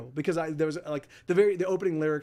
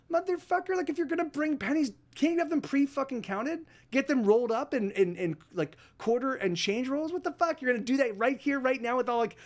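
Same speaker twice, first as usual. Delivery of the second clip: exasperated outburst pitched much higher, reliving the fury; retold angry rant; 7 semitones higher than usual. Fuming at the woman counting pennies, high-pitched retold outburst. Retold grocery store rage.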